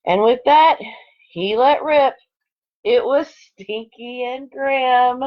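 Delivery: lively storybook read-aloud; expressive picture-book narration; reading to children. Acted. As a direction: read it like story time for second graders. Reads picture book to class.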